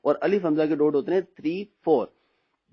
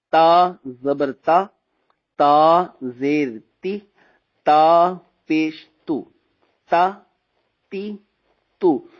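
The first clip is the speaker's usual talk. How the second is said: slow and louder, syllables held; slow loud drill; 5 dB louder, much slower than usual. Shown as a demonstration. Teacher drills students slowly and loudly. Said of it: "spelling drill".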